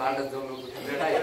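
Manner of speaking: the preacher telling himself off, softly; quiet self-scolding. Retold or imitated. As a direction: scold yourself under your breath. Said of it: "मी मला स्वतःला म्हणतो".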